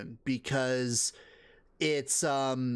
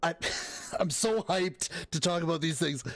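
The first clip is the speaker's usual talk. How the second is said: hyped, with laughter breaking out; amused and eager; laughing excitement; 3 semitones higher than usual. Real, not acted. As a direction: laugh your way into it and out of it. Amused co-host laughs around the words.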